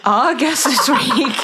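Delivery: high, slow, breaking into laughter; laughing intro. Real, not acted. Co-host thrown by being handed the guest intro, laughing.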